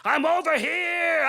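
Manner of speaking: slow, drawn-out call through the maze; faking his call. Acted. Godmother faking the dying beast, drawn-out call. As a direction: call her in slowly, pretending to be him. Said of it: "So I have to fake it".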